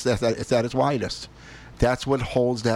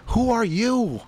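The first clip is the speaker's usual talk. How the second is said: much higher, rebuking conscience; the other voice in his head demanding he take his daughter to church; stern inner voice; 4 semitones higher than usual. Retold or imitated. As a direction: challenge yourself like a scolding conscience. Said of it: "And then the other one's saying".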